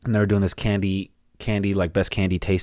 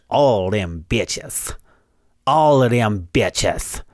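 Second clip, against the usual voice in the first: drawn out; much slower than usual. Real, not acted. Drawn out for emphasis.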